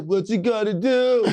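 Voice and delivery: drawn-out, high loud wail; hammed-up hollered reply; yelling back. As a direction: sing it out loud and slow. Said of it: "Che yells back".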